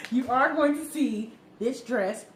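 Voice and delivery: laughing tease about the dress. Real, not acted. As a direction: giggle it out, teasing. Teasing viewers about the dress.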